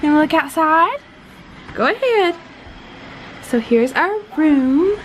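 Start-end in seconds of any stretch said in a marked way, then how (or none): none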